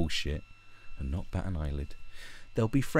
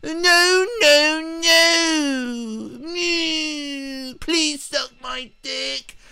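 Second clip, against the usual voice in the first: very high, wailing falsetto; hammed-up tearful pleading whine; mock virtue signaller; much slower than usual. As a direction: whimper each word out in mock anguish. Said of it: "He's thrown up three virtue signals already".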